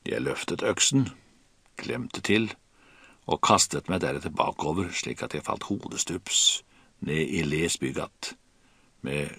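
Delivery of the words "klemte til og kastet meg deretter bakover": plain reading voice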